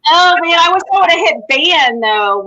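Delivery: ordinary talk, nothing marked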